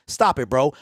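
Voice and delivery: ordinary talk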